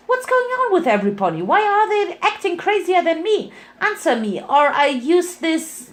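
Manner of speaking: high, fast, panicked demands; frantic threat. Acted. Pinky threatening the scientist.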